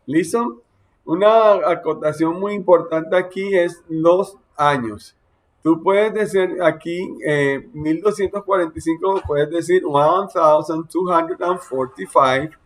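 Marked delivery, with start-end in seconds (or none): none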